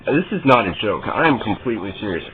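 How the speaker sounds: usual speaking voice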